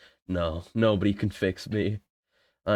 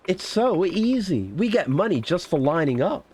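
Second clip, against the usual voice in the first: delighted paid extra; 8 semitones higher than usual. Acted. Paid actor glad of easy money, delighted role voice. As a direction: play a pleased hired customer, pitched up.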